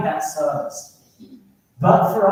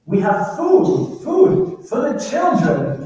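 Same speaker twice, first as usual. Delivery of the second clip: slow, much higher, louder pleading captain in broken English; captain's broken English; 10 semitones higher, 6 dB louder, much slower than usual. Retold or imitated. Fishing boat captain answering the navy by radio, slow high pleading. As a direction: draw it out high and loud like a desperate foreign captain. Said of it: "he goes".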